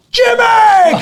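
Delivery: very high, loud imitated shout; big yell to hail someone outside; calling out. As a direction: holler a friend's name in delighted recognition. Imitating man calling across street.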